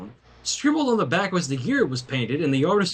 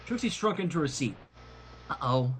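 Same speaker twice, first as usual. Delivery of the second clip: plain reading voice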